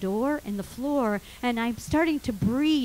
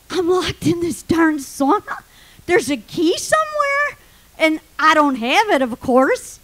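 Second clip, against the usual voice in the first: exasperated, louder thought aloud; alarmed disbelief; 4 semitones higher, 7 dB louder than usual. Retold or imitated. Storyteller's panicked thought at being locked in the sauna, alarmed. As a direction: realize the trouble, pitch jumping up. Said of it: "And I'm thinking, "What?".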